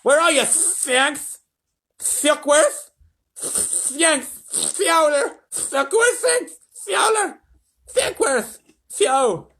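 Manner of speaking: high, loud repeated calls; desperate calling president. A narrator's reading. The president calls the lost astronauts, high and loud. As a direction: call out into dead air.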